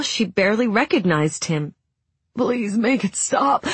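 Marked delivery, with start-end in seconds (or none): 2.4-3.7 slow, pleading through sobs; sobbing plea. A narrator's reading. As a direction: beg slowly as if crying. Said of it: "Franklin sobbed".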